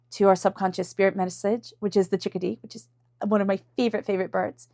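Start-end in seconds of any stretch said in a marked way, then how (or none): none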